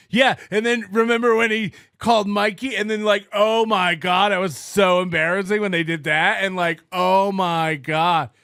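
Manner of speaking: mock breathless fan, slowing on oh my God; giddy fan mockery. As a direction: ham up a giddy fanboy squealing.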